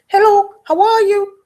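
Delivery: high squawky parrot mimicry; high parrot-like greeting. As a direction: chirp it like a pet parrot. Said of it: "the parrot says".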